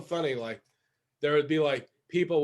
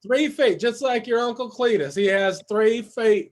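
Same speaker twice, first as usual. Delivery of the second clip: mock Southern; 4 semitones higher, 5 dB louder than usual. Joins mock Southern bit.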